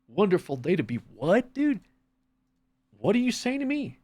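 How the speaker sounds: high, rising mock disbelief; incredulous echo. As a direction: throw the cheery greeting back in baffled disbelief. Incredulous echo of morning greeting.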